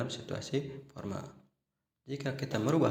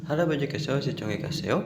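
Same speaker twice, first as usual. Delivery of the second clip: "seo" lifted at the end like a question; rising question tune; 4 semitones higher, 7 dB louder than usual. Shown as a demonstration. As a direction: ask it, letting "ga seo" rise slightly. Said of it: "Nah, seperti itu ya".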